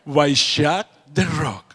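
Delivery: slow mock Catalan; lightly hammed Catalan, stretched; Catalan accent. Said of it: "No, Elena".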